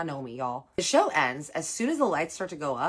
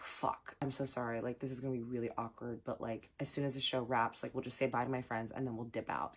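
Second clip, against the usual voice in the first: very soft, half-whispered panic; urgent hushed apology, much softer; 5 semitones lower, 9 dB quieter than usual. Retold or imitated. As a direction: keep it low so nobody in the show hears. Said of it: "Like, we're whispering".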